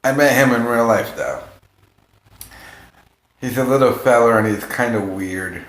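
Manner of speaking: ordinary delivery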